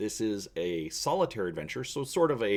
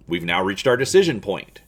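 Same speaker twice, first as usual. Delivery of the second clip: ordinary delivery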